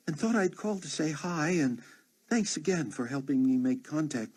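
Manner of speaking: friendly flight attendant rambling on a machine; woman's voice. Acted. Voiced woman leaving a message.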